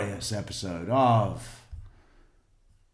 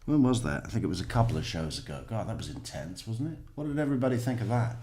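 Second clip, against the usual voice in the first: ordinary delivery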